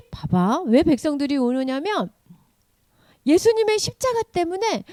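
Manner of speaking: ordinary talk, nothing marked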